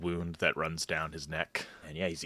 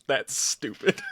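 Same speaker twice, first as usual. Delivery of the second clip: mocking laugh, higher and louder; laughing aloud; 7 semitones higher, 4 dB louder than usual. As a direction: laugh out the insult. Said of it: "audibly laughs and says out loud".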